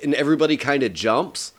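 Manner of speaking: ordinary delivery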